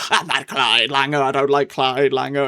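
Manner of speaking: hammed-up imitation of an overacting actress; mock the melodramatic line reading, lightly; over-the-top actress. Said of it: "albeit sometimes she is a bit over the top".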